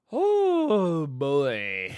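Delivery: shocked dismay. Real, not acted. Reacting to bad news.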